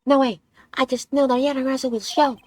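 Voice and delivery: put-on bubbly teen heroine, eager to go; excited girl voice. Streamer voices Kay in game.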